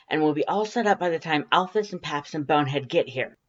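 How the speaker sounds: caffeinated rapid-fire planning; hyper. A narrator's reading. Undyne on caffeine, bossing her friend around excitedly.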